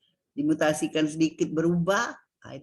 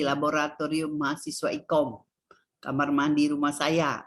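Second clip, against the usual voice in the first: "laboratorium mahasiswa Ikom, kamar mandi rumah saya" on a level tone; monotone teacher's demo of bare phrases; no intonation; 3 semitones lower than usual. Shown as a demonstration. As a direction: recite the phrases like labels, no melody. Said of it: "tidak ada intonasi".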